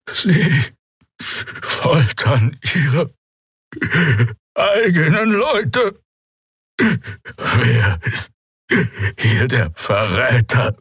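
high, slow, strained creature voice; the prisoner accusing his captors, in agony; tortured alien. A narrator's reading. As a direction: force each word out through pain.

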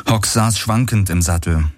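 ordinary delivery, nothing marked

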